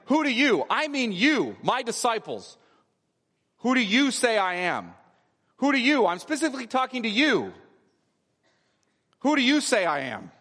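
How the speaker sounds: "you" punched high each time, singling out the listeners; insistent YOU. Shown as a demonstration. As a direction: hammer the word "you" as if pointing at the disciples. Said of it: "it's a way of saying".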